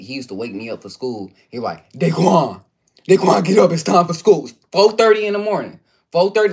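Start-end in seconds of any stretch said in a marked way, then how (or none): 1.9-4.5 loud high drill sergeant wake-up; uncle waking him. Retold or imitated. Nephew imitating uncle waking him for school, loud and high. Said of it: "who wants to hear that drill sergeant". 4.7-6.5 exasperated complaint. Real, not acted. Complaining about early wake-up.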